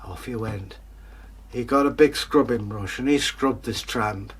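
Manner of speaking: plain reading voice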